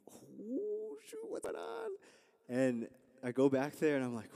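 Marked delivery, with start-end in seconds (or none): 0.1-1.9 much higher, startled little voice; alarmed surprise. Retold or imitated. Hearing a friend weep in the back, startled high voice. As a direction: jump at the sound of someone crying.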